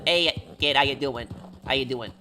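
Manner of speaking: tough New York mobster voice; New York accent. Shown as a demonstration. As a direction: lean into a movie-mobster New Yorker. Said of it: "I can do that one".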